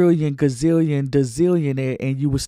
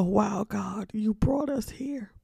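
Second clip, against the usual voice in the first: hushed awe; 5 semitones higher, 6 dB quieter, slower than usual. Retold or imitated. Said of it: "I sit back and go".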